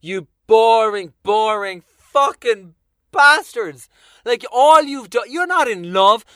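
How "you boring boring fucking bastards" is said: loud, slow contempt; drawn-out, louder disgust. Retold or imitated. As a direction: spit out the scorn. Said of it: "And I just think".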